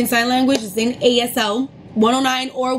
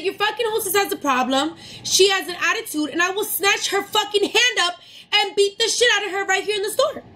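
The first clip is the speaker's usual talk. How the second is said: indignant threat; 7 semitones higher, 3 dB quieter than usual. Retold or imitated. Angry guest complaining about the hostess, indignant threat. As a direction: threaten in a raised pitch without shouting.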